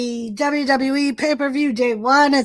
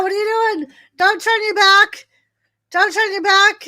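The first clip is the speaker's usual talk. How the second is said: urgent warning; 6 semitones higher than usual. Real, not acted. Warning the referee.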